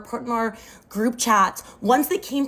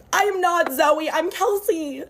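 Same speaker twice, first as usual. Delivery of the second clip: higher-pitched, insistent correction; retold insistent protest, pitch raised; emphatic insistence; 6 semitones higher than usual. Correcting man who mistakes her identity.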